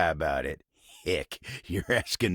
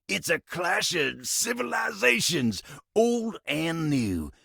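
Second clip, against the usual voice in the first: high, dramatic show tagline; hyped-up promo read; 8 semitones higher than usual. Acted. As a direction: sell it like a TV trailer. Plugs a favorite TV show.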